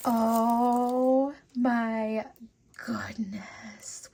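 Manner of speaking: whispered goodness in wonder; awed whisper. Real, not acted. Marvelling at the beads, a whispered goodness. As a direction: breathe it out in awe.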